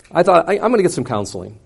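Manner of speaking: ordinary talk, nothing marked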